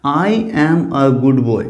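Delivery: each word set apart for the class; slow teacher's model sentence; slow example sentence. Shown as a demonstration. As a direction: read the example slowly and clearly. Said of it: "देखिए कैसे".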